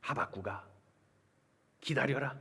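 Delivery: low, soft divine voice; hushed, deep call to a servant; God answering the prophet. Acted. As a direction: speak low and grave as God. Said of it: "자세히 가르쳐 주셨어요".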